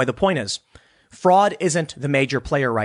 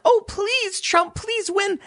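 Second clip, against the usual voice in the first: high mock begging, much higher; whiny pleading plea, pitched way up; slower than usual. Mocking his critics with a high pleading whine. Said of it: "I'm like".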